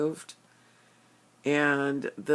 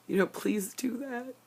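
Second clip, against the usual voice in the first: high, tearful, voice breaking; crying plea; 9 semitones higher than usual. Real, not acted. Praying to the ancestors for George, crying through it. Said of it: "this is really hard".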